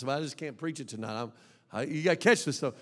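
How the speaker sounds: ordinary delivery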